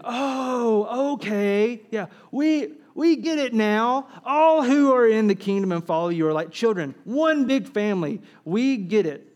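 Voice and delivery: hammed-up "now we get it" realization; eager disciples. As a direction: play the disciples loudly catching on. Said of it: "Oh, we can hear them".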